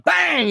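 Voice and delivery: high, sudden exclaimed bang; mimicked bang. Storyteller acting out a bat hit from behind, exclaimed bang. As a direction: punch it out like a sudden blow.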